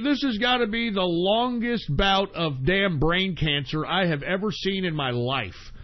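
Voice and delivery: ordinary delivery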